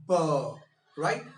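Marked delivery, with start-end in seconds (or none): 0.1-0.1 "b" with lips pressed, then released with voice; b sound demo. Shown as a demonstration. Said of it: "So I say".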